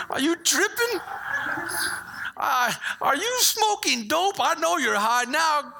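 high, slow disbelieving parent voice; mock-outraged dad refusing the car keys; incredulous parent.

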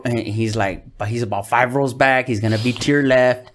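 ordinary talk

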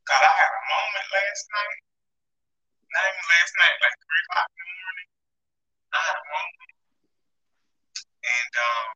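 glitchy robotic connection. Real, not acted. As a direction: voice breaking up like a robot. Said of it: "you sound like a robot right now".

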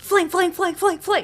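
high, sing-song fling fling; playful high chant; mock spinning sound. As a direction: imitate blades whirling. Mimicking spinning lightsabers.

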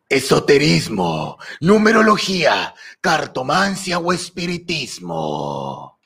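slow, lightly voiced sorcerer reading; wizard voice. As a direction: read it like an old warlock, slowly. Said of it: "yo seré un brujo". (voz de brujo lenta)

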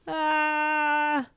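high, sustained storybook scream; acted scream. A narrator's reading. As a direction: hold a high "aah" as the victim. Spider wraps up Charles.

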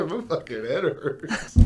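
slow, laughing pretend pain; slow, laughing mock groan of a sore head; mock groggy injured. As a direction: play the banged-up sleepwalker, slow and laughing. Mocking the injured sleepwalker.

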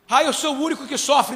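loud mocking shout of self-pity; mock whining. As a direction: shout it back like a scornful parody. Mocking a self-pitying listener.

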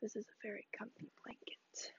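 whispered cosy aside; soft whisper. Real, not acted. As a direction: whisper it to yourself.

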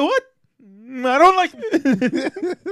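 slow, high, prissy disapproval; drawn-out put-on sniff of disdain; mock J K Rowling. Mocking the author's reaction, slow high voice. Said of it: "She's like".

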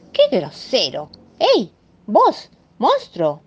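an offended housewife getting no hello; indignant Mrs Moe. Acted. Mrs Moe scolding the rude monster, indignant high voice.

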